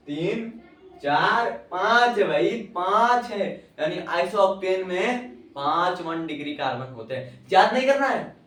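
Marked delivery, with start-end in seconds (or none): 1.0-3.7 slow, loud stress on पांच; slow emphatic पांच; drawn-out count. Shown as a demonstration. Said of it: "counting to the answer". 7.5-8.4 louder and higher, याद नहीं करना है; teacher stopping a bad habit; raised emphatic warning; insistent. Real, not acted. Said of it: "teacher insisting on concepts".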